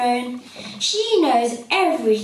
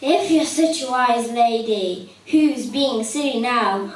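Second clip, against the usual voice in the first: ordinary delivery, nothing marked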